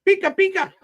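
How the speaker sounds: very high squeaky cartoon cry; bright squeaky Pikachu call; Pikachu squeak. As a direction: squeal it like a tiny cartoon critter. Pikachu voice impression.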